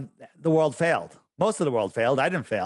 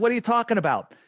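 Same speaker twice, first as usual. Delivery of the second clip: higher, mock baffled question; baffled foreigner; 8 semitones higher, faster than usual. Imitating a puzzled Saudi hearing about Boston, higher. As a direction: play someone who has never heard of it. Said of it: "They're like".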